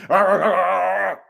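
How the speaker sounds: long, loud rolling growl; imitated dog snarl. Showing what he makes his dogs do, a mock snarl.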